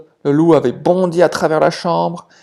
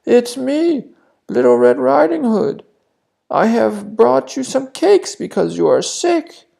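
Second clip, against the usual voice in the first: much higher, put-on little girl's voice; wolf faking girl's voice; 9 semitones higher than usual. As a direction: hide the big wolf voice behind a child's. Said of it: "trying his best to hide his big wolf voice". (the wolf at the door faking the girl's voice)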